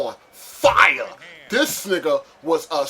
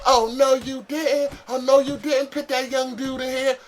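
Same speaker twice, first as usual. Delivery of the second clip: sassy flamboyant inmate; 9 semitones higher than usual. Retold or imitated. Inmate protesting to the C O about the new kid, in a high campy mocking voice. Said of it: "he was like".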